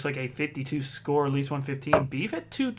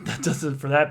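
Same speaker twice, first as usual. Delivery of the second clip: normal talking voice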